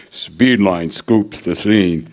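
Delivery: slow, deliberate catchphrase; slow slogan. Retold or imitated. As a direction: spell the slogan out slowly. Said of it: "We used to say".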